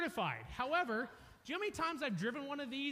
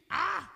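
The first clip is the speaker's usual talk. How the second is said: mock panic yell; 6 semitones higher, 8 dB louder than usual. Panicked scream retold.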